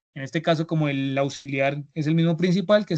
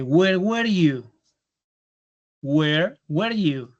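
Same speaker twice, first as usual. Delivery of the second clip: "Where were you?" said very slowly, each word separate; slow model sentence; much slower than usual. Shown as a demonstration. Teacher modelling a question for the class, very slow. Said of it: "Por ejemplo, si yo digo".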